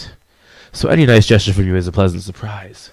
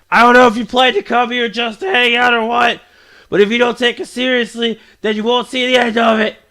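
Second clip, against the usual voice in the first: put-on higher-pitched, scolding anime girl; raised-pitch indignant schoolgirl; feisty club girl; 5 dB louder than usual. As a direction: complain about the newcomer in a girlish character voice. Voices a game character.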